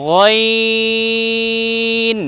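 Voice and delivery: গয়ন with a throaty gh, lips unrounded, glide held long; unrounded ghain. Shown as a demonstration. Teacher shows learner the letter ghain, long unrounded গয়ন. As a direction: stretch গয়ন out, thick at the start. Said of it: "ঠোঁট গোল করবো না".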